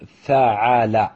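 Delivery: فعل in drawn syllables, fa-'a-la; middle vowel A. Shown as a demonstration. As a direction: sound out فعل slowly with an open A after the ayn. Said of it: "بفتح العين".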